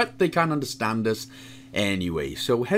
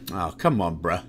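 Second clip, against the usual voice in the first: normal talking voice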